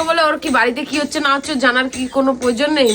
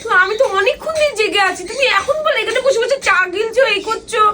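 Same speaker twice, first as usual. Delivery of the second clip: raised, higher-pitched annoyed scolding; exasperated higher-pitched reproach; irritated complaint; 10 semitones higher than usual. Real, not acted. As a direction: tell him off for sitting there sipping tea. Annoyed complaint about tea drinking.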